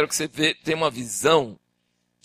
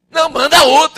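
stubborn Moses; 11 semitones higher, 9 dB louder than usual. Acted. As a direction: dig in and refuse God loudly. Moses refusing.

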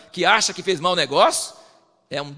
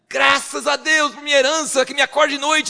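mock gratitude; 10 semitones higher, 7 dB louder than usual. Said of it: "dá graças a Deus".

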